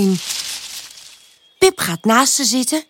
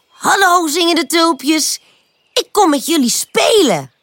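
higher-pitched, cheerful puppy greeting; eager greeting; 7 semitones higher than usual. A narrator's reading. Pip to the singing tulips, asking to play, eager. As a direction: greet them keen to play.

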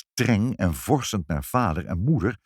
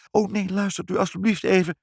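high-pitched pleading; desperate; 12 semitones higher than usual. A narrator's reading. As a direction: beg to be heard, without shouting. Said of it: "riep moeder wanhopig".

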